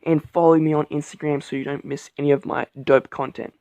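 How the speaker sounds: usual speaking voice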